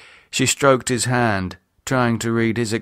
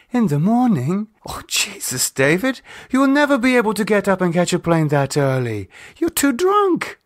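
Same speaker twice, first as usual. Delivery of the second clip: put-on higher, worried woman's voice scolding a drunk lover; a frightened girlfriend pleading, pitched up; Lisa, alarmed; 10 semitones higher than usual. A narrator's reading. Lisa alarmed at early flight.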